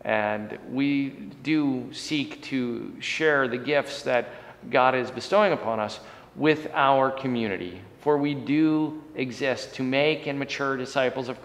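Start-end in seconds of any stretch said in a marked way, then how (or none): none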